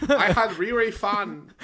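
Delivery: loud, high hammed-up Chinese accent; mock Chinese accent. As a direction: exaggerate a Chinese accent while laughing. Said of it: "Your Chinese came out".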